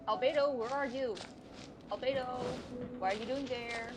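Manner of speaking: normal talking voice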